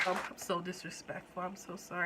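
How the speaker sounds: sheepish apology. Real, not acted. Apologizing after an outburst.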